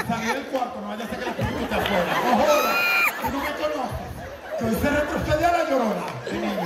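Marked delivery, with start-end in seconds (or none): 2.1-6.7 loud, higher bragging shout; cocky boast. Pretend. Comic bragging to the spirit, loud cocky shout. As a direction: brag like you scared off La Llorona.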